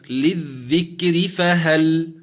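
للذكر فهل drawn out very slowly with a thin ر; Quranic recitation. Shown as a demonstration. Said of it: "أما كلمة".

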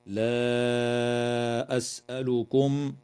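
لا أسألكم sung with the alif of لا stretched four or five beats; elongated madd. Shown as a demonstration. Sheikh demonstrating the separated madd, chanted long. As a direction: hold the long alif in لا before the hamza of أسألكم. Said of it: "من قبيل المد المنفصل، وقدره أربع أو خمس حركات".

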